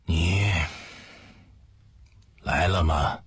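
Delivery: mild effort to speak up as the father; lightly voiced father, halting with long pauses; lekko podniesiony głos. A narrator's reading. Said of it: "提高声音问道".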